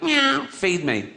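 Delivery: high, drawn-out meow into words; demanding cat. Acted. Cat wandering into civilization, high demanding meow. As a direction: beg like a hungry cat. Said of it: "and went".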